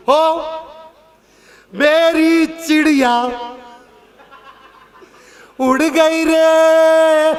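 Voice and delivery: long mock keening cry, each word stretched; grandmother's wailing lament. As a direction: ham up an old grandmother keening at the farewell. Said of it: "दादी ने रोना किया".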